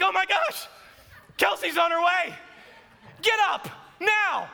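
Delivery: high, urgent imitation of a panicked wife; hammed-up alarm, punched into a sharp command; mock panic. Wife rouses family as Kelsey is coming over, panicked.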